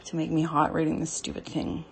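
normal talking voice

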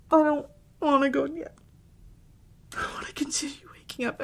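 high, sobbing declarations of love; weeping and pleading between shaky breaths; tearful, composure lost. Acted. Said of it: "guess I lost my composure".

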